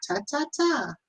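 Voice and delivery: high, soft mocking ta ta ta; mock chatter. Husband mocking his wife's talking as high babble. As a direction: imitate her chatter in a tiny voice.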